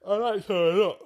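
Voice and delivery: talking through a yawn; mid-yawn, stretched words. Real, not acted. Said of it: "Sorry, I'm yawning".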